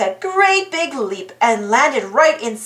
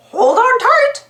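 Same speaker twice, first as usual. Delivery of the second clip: high, urgent cartoon-mouse voice; squeaky hero warning a friend; Mickey Mouse voice; 10 semitones higher, 4 dB louder than usual. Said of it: "Mickey said".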